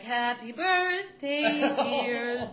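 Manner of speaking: slow, high, loud sarcastic greeting; mock sing-song. Girl mocking her own sad birthday cupcake, slow sing-song. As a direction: drag it out in mock cheer.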